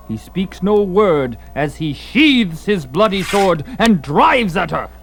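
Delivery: ordinary talk, nothing marked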